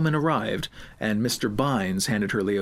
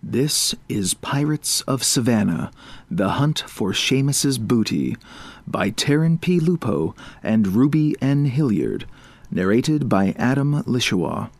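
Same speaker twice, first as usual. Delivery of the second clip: louder credits announcer voice; audiobook announcer; 4 dB louder than usual. Acted.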